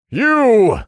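high, loud shout of accusation; furious general. Acted. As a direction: hurl it out at the enemy. Enemy general challenges the witch.

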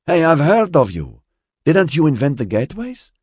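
put-on high, loud northern Mediterranean accent. A narrator's reading. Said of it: "a strong northern Mediterranean accent".

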